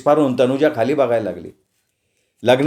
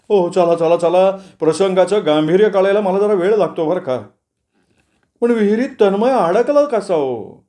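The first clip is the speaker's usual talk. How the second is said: higher put-on voice for a farmer introducing himself; farm owner Sadoba; 5 semitones higher than usual. A narrator's reading. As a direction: play an apologetic farm owner, pitched up. Narrator plays slow-witted curious farmer. (मळ्याच्या मालकाचा चढा, वेगळा आवाज)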